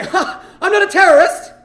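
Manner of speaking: high, loud, panicky outburst; nervous blurt. Retold or imitated. Nervous traveller blurting to a security guard, high and loud. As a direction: blurt it out too loud, pitch jumping up.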